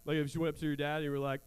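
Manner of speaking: ordinary delivery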